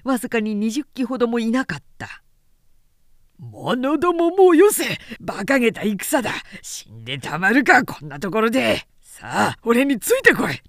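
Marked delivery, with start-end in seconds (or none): 3.4-10.6 commanding shout. Acted. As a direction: roar at the retreating soldiers to follow. General rallies fleeing troops.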